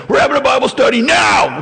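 mock-commanding yell to round everyone up; hard-charging shout. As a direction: bellow it like a zealous hard charger.

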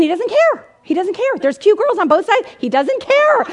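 climbing to a high, loud cry; hammed-up teacher at wit's end; mock exasperation, building. Retold teacher's escalating exasperation.

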